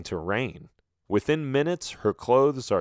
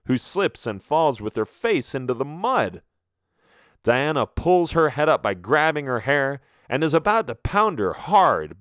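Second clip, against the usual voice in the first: ordinary delivery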